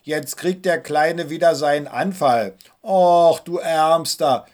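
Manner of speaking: loud mock pity on Och, slow du Ärmster; mocking sisters. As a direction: tease the little brother with fake sympathy. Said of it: "spotteten sie".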